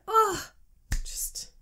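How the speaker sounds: usual speaking voice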